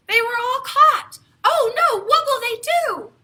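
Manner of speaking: mock alarm. A narrator's reading. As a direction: gasp with alarm for the little fish. Net catches fish, alarm.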